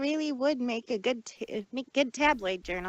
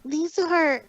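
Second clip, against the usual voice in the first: stunned surprise; 4 semitones higher, 7 dB louder than usual. Acted. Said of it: "Trixie's eyes went wide".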